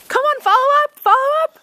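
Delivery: high, loud sideline shout; hoarse marathon cheering. Real, not acted. Parent cheering child in goal.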